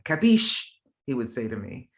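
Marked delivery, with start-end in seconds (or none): 0.0-0.6 sharp rise in pitch, louder mock demand; annoyed dad. Annoyed dad to his child, mock demand. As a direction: imitate dad demanding an answer in Italian. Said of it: "if he got annoyed".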